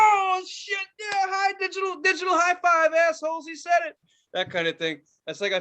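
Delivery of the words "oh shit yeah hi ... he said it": high, strained, hyped-up cheering; mock crowd going wild, high-pitched; rowdy celebration. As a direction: act out rowdy coworkers whooping it up. Acts out rowdy listeners celebrating.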